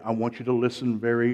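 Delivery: ordinary delivery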